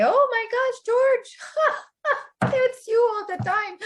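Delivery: high, teary, overjoyed mock sob; imagined swooning reply. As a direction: gush like a woman overwhelmed by love. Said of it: "I say".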